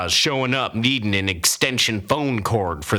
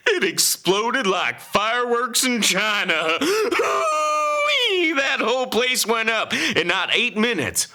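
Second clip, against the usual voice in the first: much higher, cackling through the words; laughing whoop. Acted. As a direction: crow with delight over the blaze. Gleeful fire story, laughing whoop.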